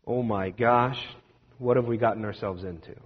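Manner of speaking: soft, low, uneasy question; scared wondering. Retold or imitated. Frightened disciples whispering among themselves, soft and uneasy. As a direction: let the worry creep in. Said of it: "now they're starting to wonder".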